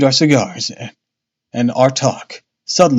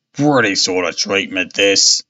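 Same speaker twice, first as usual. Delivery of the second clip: put-on old seaman voice; 6 semitones lower, slower than usual. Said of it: "he cried".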